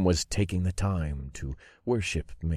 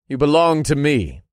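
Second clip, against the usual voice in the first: high, loud claim of ownership; imperious, raised voice; commanding declaration; 9 semitones higher, 8 dB louder than usual. A narrator's reading. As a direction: declare it forcefully as their master. Dark lord claims his followers.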